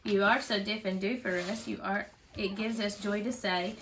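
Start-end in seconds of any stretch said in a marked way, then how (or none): none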